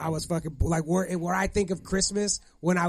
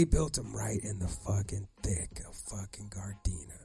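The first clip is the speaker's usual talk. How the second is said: whispered aside; 10 semitones lower, 8 dB quieter than usual. Acted. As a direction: lean in and whisper it. Dramatic aside about the neighborhood.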